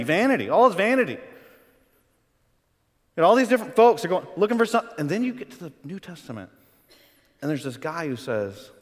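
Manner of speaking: usual speaking voice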